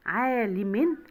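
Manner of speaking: عالم ending in -in; تنوين بالكسر. Shown as a demonstration. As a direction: sound out عالم with a final -in. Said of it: "بالتنوين بالكسر". (نطق عالم بكسرتين في آخرها)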